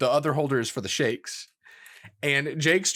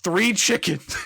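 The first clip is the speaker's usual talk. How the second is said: Jake's blurt; 7 semitones higher, 3 dB louder, slower than usual. Retold or imitated. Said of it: "he's like".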